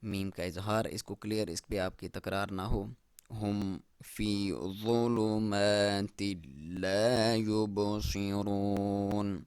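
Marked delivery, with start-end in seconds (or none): 3.3-9.4 slow, drawn-out tilawat; slow Quranic recitation. Shown as a demonstration. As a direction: chant it long and measured.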